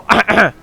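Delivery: loud throat clear. Real, not acted. Told to clear his sore throat, a loud throat clear. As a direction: clear the throat hard on request. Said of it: "जरा ऐसे करो".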